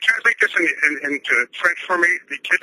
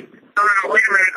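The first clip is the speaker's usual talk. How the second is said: higher-pitched urgent objection; indignant interruption; insistent protest; 5 semitones higher, 4 dB louder than usual. Real, not acted. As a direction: cut in to stop them moving on. Caller protests being cut off.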